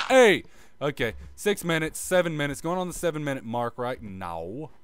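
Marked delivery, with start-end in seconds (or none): 0.1-0.4 sharp high loud call; sudden exclamation. Real, not acted. Gamer startled mid-hum, loud surprised call. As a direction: blurt it out. Sudden outburst while building.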